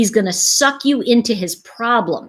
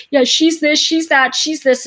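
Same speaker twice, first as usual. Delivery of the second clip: complaining date; 6 semitones higher, 4 dB louder than usual. Retold or imitated. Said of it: "talked about like".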